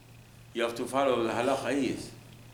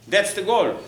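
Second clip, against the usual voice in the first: emphatic climax; 7 semitones higher, 6 dB louder than usual. Real, not acted. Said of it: "preaching climax".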